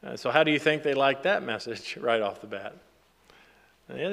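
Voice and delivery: normal talking voice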